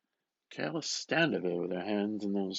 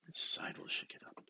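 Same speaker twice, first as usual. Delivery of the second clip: whisper; 9 dB quieter than usual. Acted. Bloom's whispered thought.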